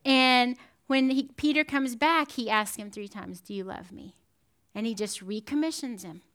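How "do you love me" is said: gentle question. Acted. Jesus asking Peter gently.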